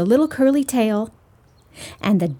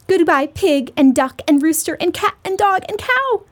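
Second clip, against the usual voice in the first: higher, lightly called goodbye; calling out; 6 semitones higher than usual. A narrator's reading. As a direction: call out lightly like a little girl. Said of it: "called the little girl".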